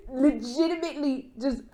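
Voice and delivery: normal talking voice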